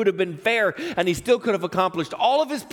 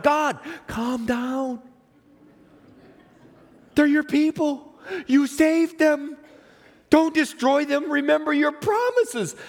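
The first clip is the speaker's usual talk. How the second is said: Moses pleading; 8 semitones higher, slower than usual. Acted. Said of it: "talking like two parents with a difficult child".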